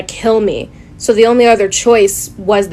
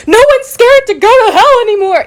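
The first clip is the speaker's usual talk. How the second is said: high, loud mocking whine; parroting Christians in a high whiny voice; 11 semitones higher, 4 dB louder than usual. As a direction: mimic a smug believer in a squeaky voice. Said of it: "Christians are like".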